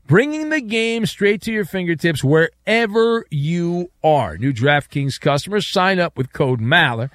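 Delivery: upbeat radio ad read. Acted. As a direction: sell it like a sponsor spot. Host reads live sponsor ad.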